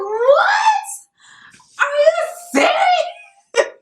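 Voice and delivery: shrieking high, astonished; shocked disbelief. Retold or imitated. To her realtor on hearing the clear to close, shrieking. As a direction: scream it in sheer disbelief. Stunned reaction to good news.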